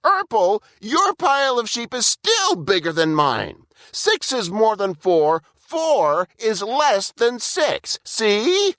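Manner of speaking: high, whiny Burple; whiny little brother. A narrator's reading. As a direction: lecture your big brother like a sulky kid. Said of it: "said Burple".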